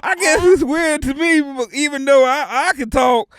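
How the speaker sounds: loud Southern cartoon character; Sandy Cheeks. Cartoon animal pondering talking creatures, loud character voice. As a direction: puzzle it out in a projected cartoon voice. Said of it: "gotta get my higher register".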